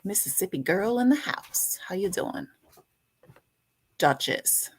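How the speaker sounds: usual speaking voice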